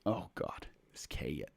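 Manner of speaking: breathy aside under the breath; muttered, unvoiced aside; whisper. Real, not acted. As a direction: whisper it to yourself.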